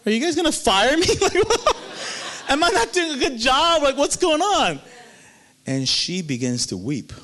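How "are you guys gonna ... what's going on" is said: high, laughing, half-joking panic; mock nervous panic. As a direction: play up the fear of being fired, laughing. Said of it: "I'm getting nervous".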